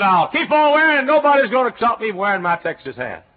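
loud, high defiant declaration; defiant shout. Retold or imitated. Preacher retelling his vow to keep the Texas hat, loud and defiant. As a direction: proclaim it stubbornly at full voice.

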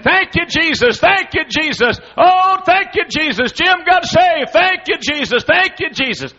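high, loud, overjoyed cries of thanks; wife rejoicing out loud that her husband got saved; joyful praise. Retold or imitated. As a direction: shout your thanks like your prayer was just answered.

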